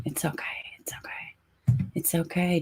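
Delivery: soft unvoiced whisper to herself; whispered self-reassurance. Real, not acted. Frustrated streamer whispering to herself after the tech fails. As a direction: whisper it to calm yourself down.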